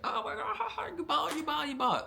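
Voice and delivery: very high, squealing mock laugh; mocking laughter. Recruits laughing at each other in reception, mocking squeal. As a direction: imitate people cackling as they tease.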